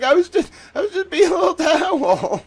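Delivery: very high, loud, fake-crying protest; mock wailing. Streamer pleads innocence to yelling editor, mock wailing. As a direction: plead like a hammed-up crybaby.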